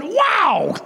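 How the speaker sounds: high, drawn-out wow; acted-out awe of a whole crowd; amazed crowd.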